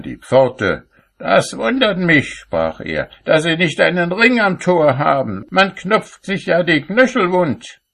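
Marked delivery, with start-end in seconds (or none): 1.2-2.3 high-pitched carping complaint; indignant grumbling. A narrator's reading. Cobbler complains at heaven's gate, high and indignant. 3.2-7.8 high-pitched carping complaint; indignant grumbling. A narrator's reading. Cobbler complains at heaven's gate, high and indignant.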